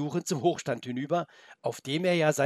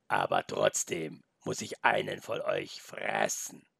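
much lower, slow wolf; deep wolf voice; 9 semitones lower, slower than usual. Acted. The wolf insists on eating one of them, low and slow.

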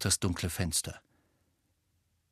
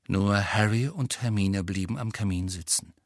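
plain reading voice

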